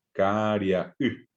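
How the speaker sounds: Caria y stretched slowly, a clear break before y; teacher's slowest take of Caria y; very slow separated syllables. Shown as a demonstration. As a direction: drag it out and pause before the y. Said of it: "separo la voz allí".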